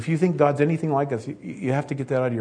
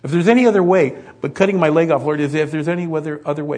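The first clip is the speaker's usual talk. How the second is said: higher, mock-desperate bargaining with God; acted frantic prayer for a way out; desperate praying; 4 semitones higher, 4 dB louder than usual. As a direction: plead urgently, swelling louder in the middle. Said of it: "Have you found yourself praying that?".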